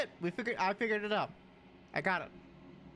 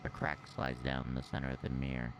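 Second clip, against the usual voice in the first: much lower, menacing villain voice; evil voice; 4 dB quieter than usual. Shown as a demonstration. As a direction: growl it like a sinister villain. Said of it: "Let me do my evil voice".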